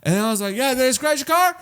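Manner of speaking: squeaky reassuring worker; high friendly employee voice. Retold or imitated. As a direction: play the employee high and kind.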